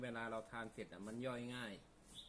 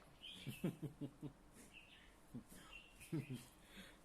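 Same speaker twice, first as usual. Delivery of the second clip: quiet laugh; 3 semitones higher, 7 dB quieter than usual. Real, not acted. Laughs at teasing.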